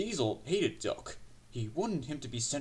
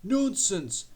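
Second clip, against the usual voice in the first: high-pitched dismissal; indignant engine; 7 semitones higher, 4 dB louder than usual. A narrator's reading. Henry rebukes the complaining Diesel.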